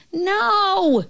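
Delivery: high, drawn-out call; mock shout. A narrator's reading. Girl correcting the Nobble from afar, high mock shout. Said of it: "the girl's voice shouted".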